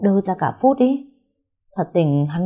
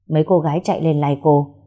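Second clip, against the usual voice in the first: ordinary delivery, nothing marked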